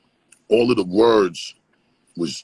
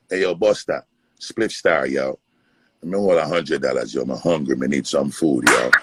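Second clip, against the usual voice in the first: put-on a light Jamaican accent with yo tacked on; imitating the Jamaican corner hustler begging for money; mimicking Jamaican accent; 5 semitones lower than usual. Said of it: "I was just kinda mimicking this Jamaican dude".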